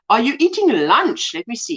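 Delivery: ordinary delivery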